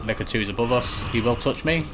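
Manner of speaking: normal talking voice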